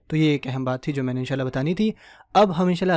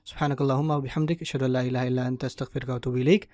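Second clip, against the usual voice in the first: quiet, even recitation; closing prayer; 3 semitones lower, 4 dB quieter than usual. Acted. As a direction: recite the closing dua softly. (धीमी दुआ पढ़ना)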